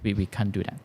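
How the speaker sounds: ordinary talk, nothing marked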